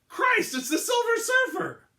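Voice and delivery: high, loud, stretched-out shock; startled cry. A narrator's reading. Uniformed cop spotting the Surfer, high startled cry. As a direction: cry out in disbelief at the sky. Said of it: "cried out".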